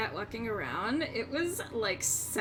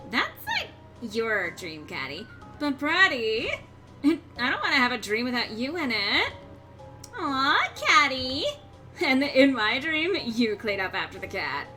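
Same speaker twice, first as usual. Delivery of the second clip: put-on drawn-out bubbly valley girl; slow and dreamy, like a mall girl; Valley Girl accent. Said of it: "Valley Girl accent".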